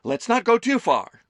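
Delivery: mock shock. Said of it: "Amelie feigned shock".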